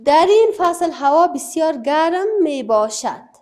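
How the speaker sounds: loud, clear reading from the book to the class. Shown as a demonstration. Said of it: "در کتاب هم گفته". (خواندن بلند و رسای متن کتاب برای صنف)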